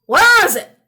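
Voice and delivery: high, loud holler. Acted. Old man hollers at the ghoul, high and loud. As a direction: yell it at the ghoul. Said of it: "hollered the old man".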